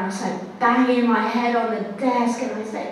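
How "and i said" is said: usual speaking voice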